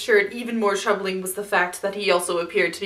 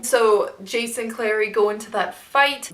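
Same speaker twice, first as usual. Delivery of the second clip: usual speaking voice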